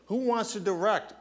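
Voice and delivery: pitched well up, asking the crowd; high rhetorical question. Acted. Preacher asking the congregation, pitched high. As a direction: throw the question high to the room. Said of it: "It's the Lord".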